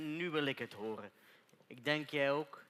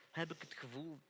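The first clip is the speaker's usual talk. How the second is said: crying; 4 semitones lower, 9 dB quieter than usual. Acted. Emotional confession, voice breaking.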